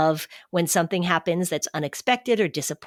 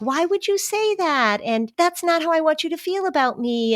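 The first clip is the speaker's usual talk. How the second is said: high-pitched mock hurt protest; wounded mom; 11 semitones higher than usual. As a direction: imitate an overreacting mother taking it personally. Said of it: "the mom feels like she has to say".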